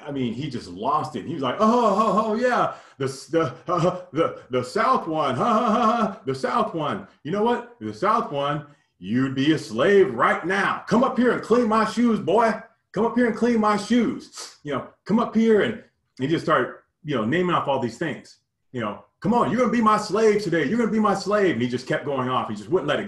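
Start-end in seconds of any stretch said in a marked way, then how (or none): 1.6-15.6 high, loud, hooting Southern drawl, laughing; mocking teacher, laughing. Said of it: "putting on this, big time, uh, country accent". 19.2-21.5 high, loud taunting Southern voice; mocking country accent. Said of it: "big time country accent".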